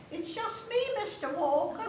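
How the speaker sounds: put-on high, small child's voice; imitating little Jenny sneaking into bed; squeaky kid saying her line; little girl voice. Little Jenny quoted in story.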